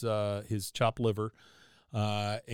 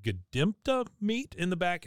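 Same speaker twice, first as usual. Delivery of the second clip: higher, slow drawn-out; Carl offering; 7 semitones higher than usual. Retold or imitated. Carl tempting Bobby with meat, slow higher voice. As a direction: savor the word slowly, pitched up.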